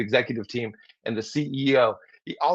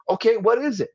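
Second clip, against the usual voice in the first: matching excitement; 9 semitones higher than usual. Shown as a demonstration. Listener matching colleague's excitement, eager and higher. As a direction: lean in, eager to hear. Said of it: "a tone that matches the tone".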